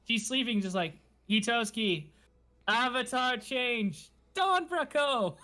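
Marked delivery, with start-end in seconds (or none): 1.3-5.4 higher, slow, laughing hero call-out; drawn-out sentai transformation shout, half laughing; mock transformation call. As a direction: ham up a sleepy henshin command. Heroine transforms while asleep.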